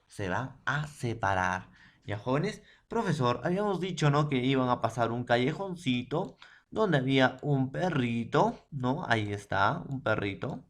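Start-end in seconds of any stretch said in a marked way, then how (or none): none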